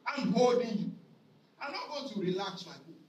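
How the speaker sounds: ordinary talk, nothing marked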